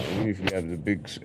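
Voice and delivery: ordinary talk, nothing marked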